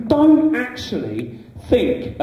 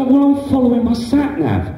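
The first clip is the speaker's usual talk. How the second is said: defensive driver; 7 semitones higher, 4 dB louder than usual. Retold or imitated.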